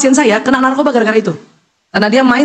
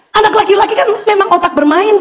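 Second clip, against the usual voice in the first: usual speaking voice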